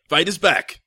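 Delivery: loud, high reveal, like a trailer line; dramatic announcement. Acted.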